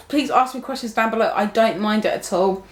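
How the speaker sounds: normal talking voice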